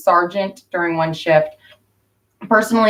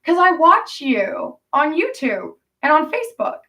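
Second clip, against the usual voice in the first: imitating Priscilla; 7 semitones higher than usual. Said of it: "And she goes".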